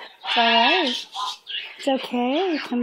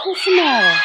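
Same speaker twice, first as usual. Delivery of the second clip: higher, louder pet-talk question; raised sing-song coo; 5 semitones higher, 6 dB louder, faster than usual. Real, not acted. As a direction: ask it up and loud like to an excited puppy. Cooing to a pet.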